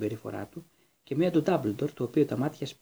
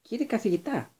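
high, scandalised squeal; shriek; 9 semitones higher than usual. A narrator's reading. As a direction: pipe up high in shocked protest. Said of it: "τσίριξε η κυρία Πόμφρι".

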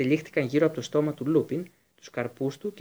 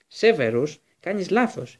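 urgent insistence; 4 semitones higher, 6 dB louder, slower than usual. A narrator's reading. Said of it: "είπε επιτακτικά ο Λούπιν".

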